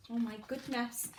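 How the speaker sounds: plain reading voice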